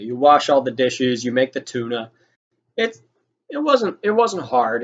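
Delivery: ordinary talk, nothing marked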